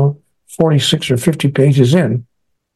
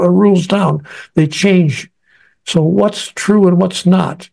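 exasperated bargaining, rising higher; retold angry pleading; 5 semitones higher than usual. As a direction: plead your case to God, pitch climbing in frustration. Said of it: "I wrote an angry letter to God".

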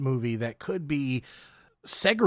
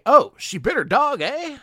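put-on higher, louder absent-minded old farmer; Uncle Henry; 11 semitones higher, 7 dB louder than usual. Co-host quotes a comic movie moment, absent-minded uncle voice. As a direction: play up the absent-minded uncle, rising on the last word. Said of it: "the absent-minded wit that went into that".

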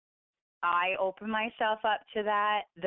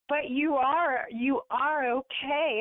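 higher and louder, slow and soothing; lightly voiced reassuring mother; 7 semitones higher, 4 dB louder, slower than usual. Mother in the dream reassuring her waking daughter, slow and higher. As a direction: gently insist she is okay.

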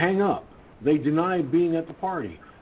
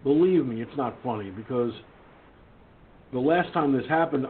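ordinary talk, nothing marked

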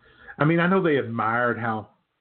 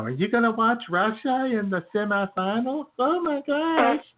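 gushing computer lady; 12 semitones higher than usual. Retold or imitated. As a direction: ham up an overexcited lady calling about the semifinals. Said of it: "crazy computer lady was gonna call her up".

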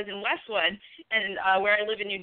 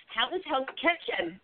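slow, higher-pitched mock Jersey accent; 5 semitones higher, 4 dB quieter than usual. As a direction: imitate a bartender's drawn-out Jersey accent. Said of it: "in the Jersey accent".

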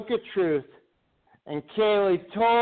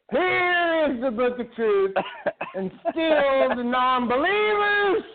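lightly high preacher sermon; cult leader; 5 semitones higher than usual. Acted. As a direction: preach it to the faithful. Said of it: "you sound like a fucking cult leader".

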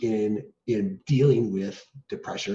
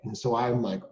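ordinary talk

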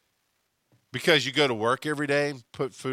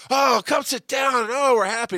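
much higher, shouted family welcome; big loud family voice; 8 dB louder than usual. Retold or imitated. The narrator mimics a big TV family greeting guests, loud and high. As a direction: boom out the welcome like a jolly crowded household. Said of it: "are all big and loud".

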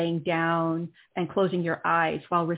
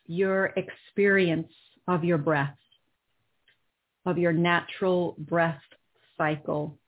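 right in your ear. Acted. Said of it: "guided breathing into body".

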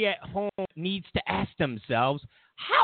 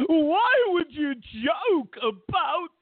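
high, slow, whiny clutching-pearls voice; shrill mock horror at a crude joke; mock outraged prude; 9 semitones higher, slower than usual. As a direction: wail it like a scandalized prude. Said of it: "They're gonna go".

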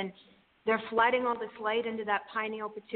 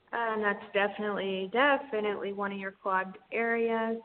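airy breathy mystical healer. Acted. Guides an energy healing session.

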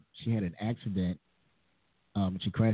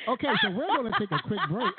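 laughing through it; 12 semitones higher, 4 dB louder, faster than usual. Real, not acted. Joking fake break, laughing.

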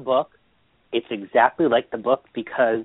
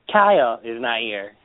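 normal talking voice

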